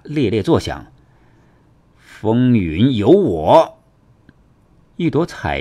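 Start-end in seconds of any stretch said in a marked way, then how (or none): 2.0-3.8 slow, weighty spell cry; commanding battle call. Acted. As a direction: proclaim the technique word by word. Fighter calls out his technique.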